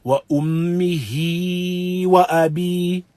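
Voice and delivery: slow, the ه of وأمه and the ي of وأبي dragged too long; overlong recitation. Shown as a demonstration. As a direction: stretch وأمه وأبي musically past its due. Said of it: "we do not elongate it more than ... its haq".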